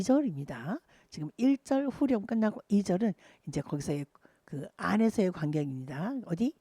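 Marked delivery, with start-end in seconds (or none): none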